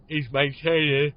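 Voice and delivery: slow words dragged out by a real yawn; yawning mid-word. Real, not acted. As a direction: talk through the start of a yawn. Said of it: "I always yawn when I'm podcasting".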